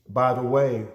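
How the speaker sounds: ordinary talk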